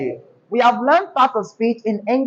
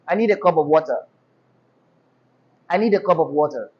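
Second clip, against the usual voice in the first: lower, flat Nigerian intonation; Nigerian accent; 6 semitones lower, much faster than usual. Shown as a demonstration. Teacher showing the class what a Nigerian will say, flat Nigerian accent. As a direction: say it evenly, low, in a Nigerian accent. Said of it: "that's what Nigerian will say".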